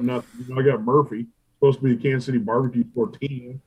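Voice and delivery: ordinary talk, nothing marked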